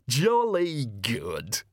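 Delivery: hearty boss voice, very drawn out; jovial store manager. Acted. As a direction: stretch it out with booming satisfaction. Jovial manager replies to cashier.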